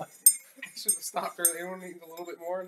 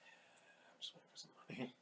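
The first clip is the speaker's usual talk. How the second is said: hushed whispered aside; barely voiced, sheepish hush; 6 semitones lower, 21 dB quieter than usual. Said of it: "he's just like".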